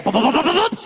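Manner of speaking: rapid-fire footsteps sound effect; imitated footsteps. Husband hearing wife charge upstairs, imitated stomps. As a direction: make feet pounding upstairs with your mouth. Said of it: "She came back up the stairs".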